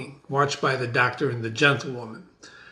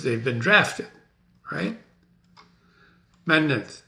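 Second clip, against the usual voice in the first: plain reading voice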